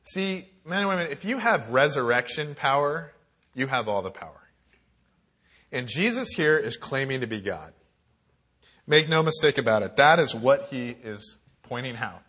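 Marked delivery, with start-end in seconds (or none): none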